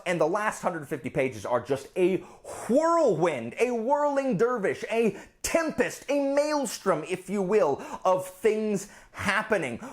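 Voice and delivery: high-pitched, gushing excitement; real, runaway enthusiasm; getting too excited. Real, not acted. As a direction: get carried away raving, pitch riding high. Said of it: "I'm getting too excited".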